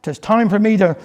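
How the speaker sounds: plain reading voice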